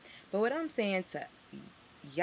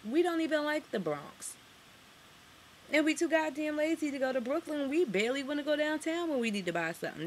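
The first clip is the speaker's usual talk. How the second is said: pitched-up, worked-up complaint; riled up, voice climbing higher; heated, higher rant; 9 semitones higher than usual. Real, not acted. Harlem native telling viewers, pitched-up excited rant. Mocking Harlem locals' laziness.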